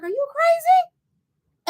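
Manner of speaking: very high, drawn-out retold disbelief; mock disbelief. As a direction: stretch it out high, acting appalled. Retold disbelieving reaction on phone.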